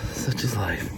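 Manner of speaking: quiet resigned whisper. Real, not acted. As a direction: breathe it out as a shrug. Resigned aside to the camera.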